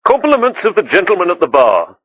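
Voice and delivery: polite high waiter voice; a deferential waiter offering a gift. A narrator's reading. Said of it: "the waiter said".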